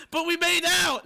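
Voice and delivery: high, loud indignant yell; outraged protest. Retold or imitated. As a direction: yell it like it's a betrayal. Retelling protest to the girl.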